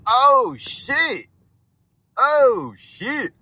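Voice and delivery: high yelped oh shit, loud and sudden; startled shriek. Real, not acted. Driver bottoming out on bumps yelps in real alarm. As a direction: yelp as the car bottoms out. Car hits another bump.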